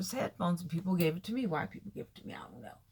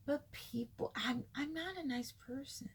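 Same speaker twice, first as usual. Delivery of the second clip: soft, breathy confession; confiding whisper; 6 semitones higher, 6 dB quieter than usual. Real, not acted. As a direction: lean in and whisper it. Confiding aside to viewers.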